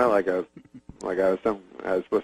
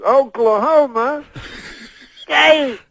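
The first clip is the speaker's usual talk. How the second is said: very slow, high, loud call with a long pause; Bill Cosby, slower; 14 semitones higher, 4 dB louder, much slower than usual. Retold or imitated. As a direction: stretch each word and hold before the last. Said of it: "then he says it slower".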